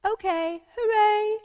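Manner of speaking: very high, loud hooray; celebratory cheer. Real, not acted. Reader celebrates the end of the story, celebratory cheer. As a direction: cheer the ending at full voice.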